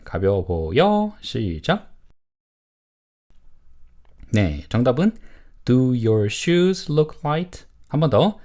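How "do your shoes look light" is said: slow, word-by-word model question; model reading. Shown as a demonstration. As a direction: read it slowly for learners to repeat. Teacher models answer for repetition. (천천히 또박또박 읽는 시범)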